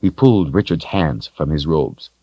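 ordinary delivery

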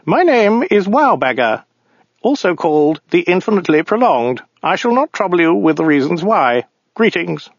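high, prim and smug alien; pompous little official, pitched high; weaselly voice. Acted. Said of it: "in a small, weaselly voice".

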